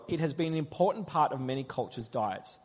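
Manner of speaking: ordinary talk, nothing marked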